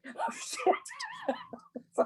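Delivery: sudden high startled cry; a jolt of panic at the news; shocked yelp. Retold or imitated. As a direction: blurt it out in shock. Caught off guard by commercial call.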